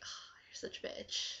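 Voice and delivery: breathless whisper of a playful insult; whispered jab. Retold or imitated. The aunt teasing her niece, a whispered jab. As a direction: hush it like a secret tease. Aunt's jab after the dinner praise.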